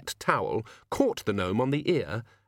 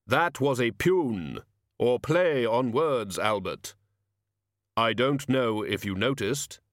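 slow, deliberate, humourless Death; solemn Death; slower than usual. Acted. Death explaining his pun to his servant, solemn. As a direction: explain the joke with ponderous care.

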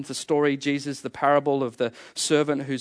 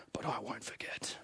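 whisper; 4 semitones lower, 18 dB quieter than usual. Retold or imitated. Voicing a resentful inner thought.

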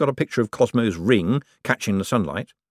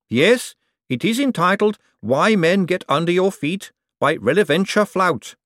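slow earnest stone voice; earnest golem monotone; 9 semitones higher than usual. Acted. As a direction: recite it flat and earnest like a golem. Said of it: "said Gladys earnestly".

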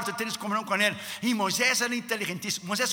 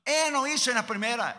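ordinary talk

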